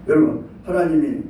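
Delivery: ordinary talk, nothing marked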